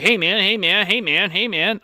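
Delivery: loud, insistent "hey, man" calls; nagging repeated calls. Retold or imitated. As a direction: pester someone over and over.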